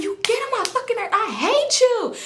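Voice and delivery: high hammed-up tearful screaming; crying scream, retold. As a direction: act out screaming through tears, slowing at the end. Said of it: "I'm just crying. I'm screaming".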